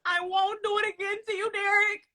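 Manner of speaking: mock sobbing. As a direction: sob it out like a soap opera. Mock tearful plea to Derek.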